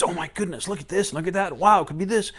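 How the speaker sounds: put-on like a kid seeing presents for the first time; breathless mock delight; excited. Gut voiced as excited child.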